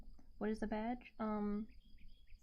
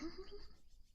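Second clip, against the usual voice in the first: short high laugh; 10 semitones higher, 6 dB quieter than usual. Real, not acted. Laughs at herself.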